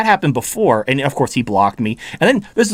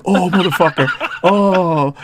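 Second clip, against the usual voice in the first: mock triggered; 3 semitones higher, slower than usual. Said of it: "he got triggered as shit".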